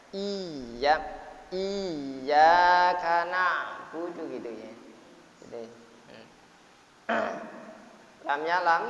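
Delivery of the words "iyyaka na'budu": Iyyaka na'budu slow and louder, leaning on the doubled yy of Iyyaka; pressed shadda. Shown as a demonstration. Teacher demonstrating the pressed shadda, slow and loud. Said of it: "ada penekanan".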